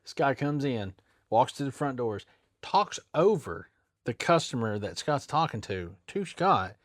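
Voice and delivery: ordinary talk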